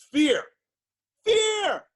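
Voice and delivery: FEAR shouted high and loud; emphatic shout. Real, not acted. Speaker hammering home his one-word answer to doctors, shouting it.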